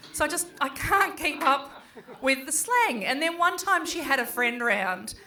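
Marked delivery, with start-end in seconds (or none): none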